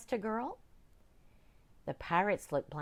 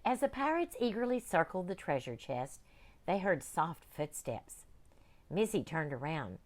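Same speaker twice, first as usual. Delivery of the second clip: ordinary delivery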